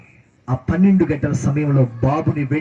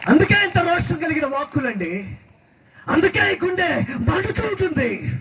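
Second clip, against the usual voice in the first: high, burning sermon cry; zealous preaching; 10 semitones higher than usual. Real, not acted. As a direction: preach it with rising, high-pitched zeal. Preacher at full force. (రోషంతో ఎత్తైన స్వరంలో ప్రసంగం)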